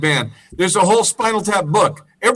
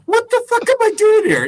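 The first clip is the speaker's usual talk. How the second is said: bewildered onlooker. Retold or imitated.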